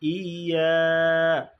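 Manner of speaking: ইয়া with the vowel held long; long drawn ইয়া. Shown as a demonstration. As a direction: stretch ইয়া slowly. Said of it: "তাহলে আমরা এভাবে পড়ব".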